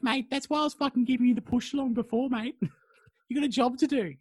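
high, loud nagging voice; scolding Shamat. Retold or imitated. Shamat scolds Enkidu, acted.

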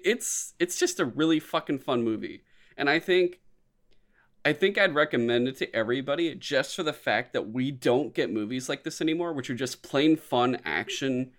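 ordinary delivery

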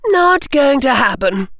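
put-on high young girl's refusal; Susan Bones. A narrator's reading. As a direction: speak way up high as a young girl. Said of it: "said the young voice of Susan Bones".

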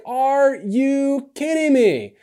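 can't believe they fell asleep; Jesus finding his disciples asleep, exasperated; exasperated disbelief. Retold or imitated. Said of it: "he's like".

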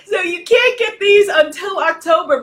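high, loud, laughing with joy; excited shout. Real, not acted. Leader overjoyed showing her new deck, shouting. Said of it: "This is my deck".

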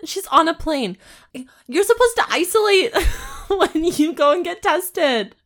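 high-pitched, laughing indignation; incredulous protest. Real, not acted. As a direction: object in amused outrage. Indignant reaction to the story.

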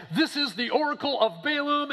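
hammed-up prophetic intro; grand prophet announcement. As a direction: proclaim it like a pompous seer. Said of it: "He's like".

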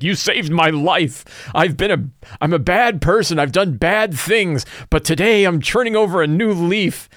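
a reformed thug gushing gratitude; emotional bandit. Retold or imitated. Bandit voiced, emotional confession.

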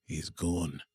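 low, soft attempt at Scottish; Scottish accent. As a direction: try a mild Scottish lilt. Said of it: "than my Scottish accent".

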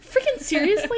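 high-pitched, disbelieving squeal; retold shock at finding the store too soon; exasperated disbelief. Narrator retells spotting the store, squeaky disbelief. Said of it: "I'm like".

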